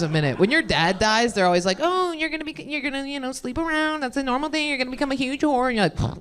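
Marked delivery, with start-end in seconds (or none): 1.8-5.7 higher, softer mock concern; singsong imitation of well-meaning people; lightly mocking know-it-all tone; mock sympathetic advice. Mocking what people tell her.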